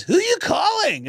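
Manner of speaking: high, drawn-out excitable shout; mock high-pitched demand. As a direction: imitate a nosy rocker yelling way up high. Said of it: "and he goes".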